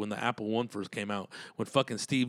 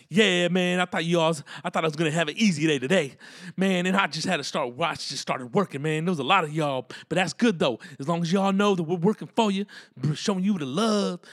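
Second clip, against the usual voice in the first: loud, higher-pitched Louisiana cook; animated griping that turns generous, louder and up; 5 semitones higher, 5 dB louder than usual. Retold or imitated. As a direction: play the hard-working kitchen brother from Louisiana.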